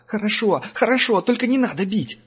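high, panicky begging; the threatened angel begs Herman, high and panicky; pleading. A narrator's reading. Captive begs not to be hit.

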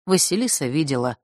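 ordinary delivery, nothing marked

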